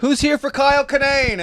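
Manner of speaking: high, loud host call; rally the crowd like an MC; announcer hype. Retold or imitated. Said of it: "host hyping the crowd".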